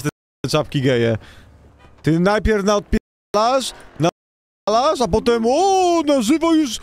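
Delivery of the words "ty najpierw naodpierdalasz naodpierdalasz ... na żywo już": rising, slow, accusing; angry confrontation. Acted. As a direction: build the accusation higher. Confronting a victim on stream.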